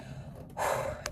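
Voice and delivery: long unvoiced out-of-breath exhale; heavy tired breath out; breathless sigh. Real, not acted. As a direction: blow the air out, winded. Shows being out of breath.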